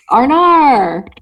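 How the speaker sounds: loud, high mock-Aussie "arnar"; attempted Australian accent. Host tries the requested Aussie accent, hammed-up Australian. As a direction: ham up the Australian vowels. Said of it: "do an Australian accent".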